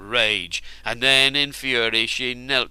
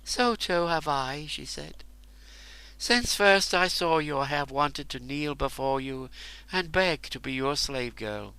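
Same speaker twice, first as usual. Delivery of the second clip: softer, tender woman's voice; smiling through tears; 3 semitones higher, 5 dB quieter than usual. Acted. Woman confessing love to the warrior, soft and tender. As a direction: let the tears turn to love. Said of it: "smiled through her tears".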